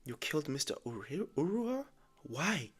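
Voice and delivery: stunned questions jumping up in pitch; acted shock. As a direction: play someone who can't take in the news. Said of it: "He's shocked. He's like".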